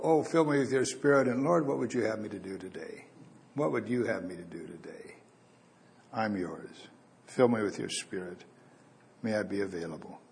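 quiet, humble prayer voice; soft prayer. Retold or imitated. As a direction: pray it softly, surrendering. Morning prayer to the Lord.

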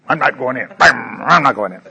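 mock pout like a sulking kid; hint of a pouting boy; pouting little boy. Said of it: "this little boy out here pouting".

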